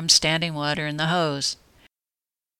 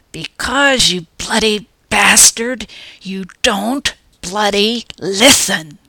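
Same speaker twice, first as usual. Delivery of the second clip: higher, louder, slow enraged yell; furious shout; 4 semitones higher, 6 dB louder, slower than usual. Acted. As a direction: spit each word out in rage. Furious narrator shaking him.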